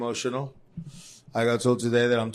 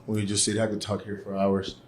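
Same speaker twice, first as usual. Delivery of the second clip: ordinary delivery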